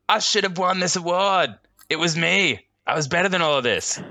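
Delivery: high, loud, pompous complaint; arrogant outrage. Pretend. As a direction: play the sore loser. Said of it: "be like".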